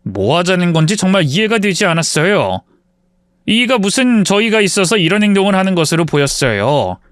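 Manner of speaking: usual speaking voice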